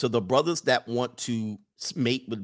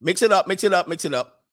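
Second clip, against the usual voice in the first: louder, higher coach-like call; 6 semitones higher, 4 dB louder than usual. Acted. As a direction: shout the order to break up and mix. Calling the men again.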